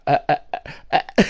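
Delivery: halting, stuttered grunts in mockery; mock stumbling. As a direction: imitate being lost for words. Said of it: "she turns into a stumbling butter".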